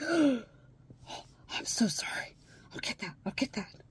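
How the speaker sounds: imitating a panicked gasp, then hushed apologies; retold freaked-out apology. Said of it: "he freaked out".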